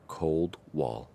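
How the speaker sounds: plain reading voice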